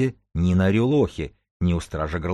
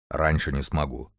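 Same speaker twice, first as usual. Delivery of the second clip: low-pitched, understated giant; deep heavy bass; 4 semitones lower, 4 dB quieter than usual. A narrator's reading. Mathematician, standing to leave, states his limit in bass. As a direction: say it down in a bass register. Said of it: "Такого глубокого и тяжёлого баса".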